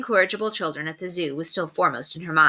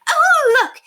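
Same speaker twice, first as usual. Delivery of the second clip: shrill, loud, very high squeal; excited lady spotting a fancy envelope; 9 dB louder than usual. Acted. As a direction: squeal with delight. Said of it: "Lady Constance squealed".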